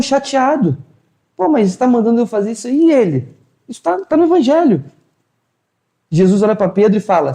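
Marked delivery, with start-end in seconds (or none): none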